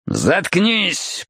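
put-on irritated drunken growl; growled command. A narrator's reading. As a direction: snarl to shut her up. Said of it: "прорычал тот".

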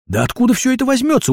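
high, quick astonished question; surprised. Acted. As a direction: ask in amazement, voice shooting up. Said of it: "удивлялся Вася". (удивлённо, высоко и быстро)

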